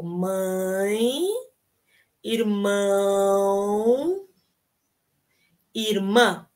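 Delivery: irmão held long and slow, nasal vowels drawn out; slow drill of mãe, irmão, irmã; prolonged nasals. Shown as a demonstration. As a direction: stretch each nasal vowel a bit. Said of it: "prolonga um pouco".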